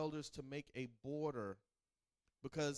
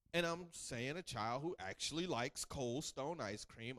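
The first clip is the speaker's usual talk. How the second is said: ordinary talk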